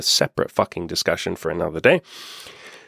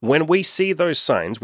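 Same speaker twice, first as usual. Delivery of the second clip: normal talking voice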